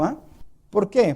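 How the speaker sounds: ordinary talk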